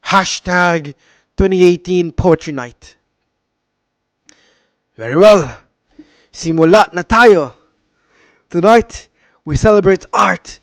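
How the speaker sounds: put-on loud, hammy Filipino-accented MC voice; a tea house owner hosting in a thick Filipino accent. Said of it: "Faking a Filipino accent".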